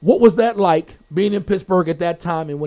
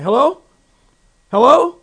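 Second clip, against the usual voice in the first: rising, louder hello into a dead phone; calling hello; 7 semitones higher, 10 dB louder than usual. Retold or imitated. Calling into a dead phone line, louder each time.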